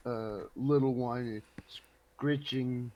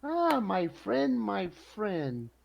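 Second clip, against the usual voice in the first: effusive greeting; 10 semitones higher, 5 dB louder than usual. Acted. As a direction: greet him grandly, pitch swooping up high. Effusive greeting of merchant in play.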